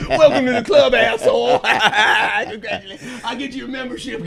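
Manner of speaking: high loud laughing welcome to the club; laughing ribbing. Real, not acted. Friend teasing the newlywed, laughing loud and high. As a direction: cackle as you welcome him into married life.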